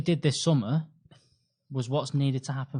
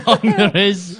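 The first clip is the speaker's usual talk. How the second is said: laughing; 7 semitones higher, 11 dB louder, slower than usual. Real, not acted. Laughing at the mishap.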